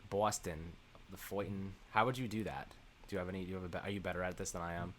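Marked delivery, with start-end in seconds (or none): 0.1-1.7 quiet, unsure try at Boston vowels; attempted Boston accent. Shown as a demonstration. Host unsure how to do it, trying a soft Boston accent. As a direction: test out a Boston accent under your breath. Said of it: "a Boston accent".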